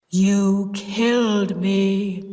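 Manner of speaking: slow, heavy ghost voice; deep terrible voice. Acted. Sis posing as the dead husband, slow menacing ghost voice. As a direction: drag each word out like a vengeful ghost. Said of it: "Sis said in the deep voice".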